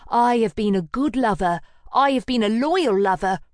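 loud, high wounded protest; indignant protest. Acted. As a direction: insist on it hotly. Llewellyn protesting.

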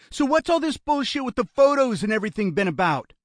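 high, loud, fast demand; agitated challenge. A narrator's reading. Kidnapper demanding his brother explain the photos, agitated. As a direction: blurt out an upset challenge.

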